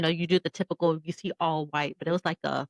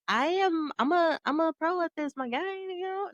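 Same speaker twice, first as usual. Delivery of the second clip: much higher mock-cocky voice; high-pitched jokey bragging; joking boast; 12 semitones higher than usual. Joking boast, then "just kidding.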